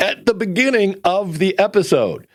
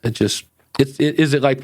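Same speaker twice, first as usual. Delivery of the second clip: ordinary talk, nothing marked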